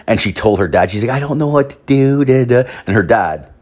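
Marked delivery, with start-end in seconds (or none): 1.1-2.7 higher-pitched imitation of a flustered daughter; lightly hammed-up helpless whine, trailing into filler; mock freaking out. Daughter telling her dad she's lost, mock freaking out. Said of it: "she's freaking out".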